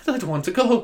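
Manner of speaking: high, sorrowful mock plea; imitated sad farewell. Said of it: "he's very sad".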